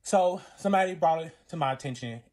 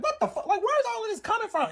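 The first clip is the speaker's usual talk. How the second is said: very high-pitched disbelief; incredulous, retold in a high voice; shocked protest. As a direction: squeal it out, baffled by the sudden attack. Retold shocked comeback in quarrel.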